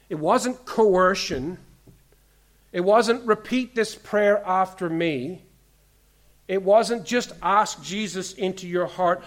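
loud, high, driving preacher's voice; impassioned pulpit delivery, slowed for weight; full-force preaching. Acted. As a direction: preach it hard to the whole room.

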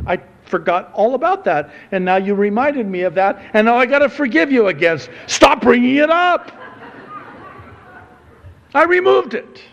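acted exasperation building from casual to a high shout; comic fed-up outburst, escalating; exasperated God. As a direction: start offhand, speed up, end yelling in mock frustration. Voices God, fed up with reminders.

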